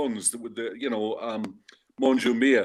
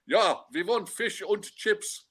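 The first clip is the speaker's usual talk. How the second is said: German fan voice; 9 semitones higher, slower than usual. Retold or imitated. German fans asking for chips.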